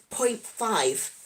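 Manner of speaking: ordinary talk, nothing marked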